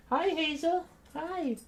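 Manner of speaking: very high sweet hello; high doggy greeting. Real, not acted. Streamer greeting puppy Hazel, very high coo.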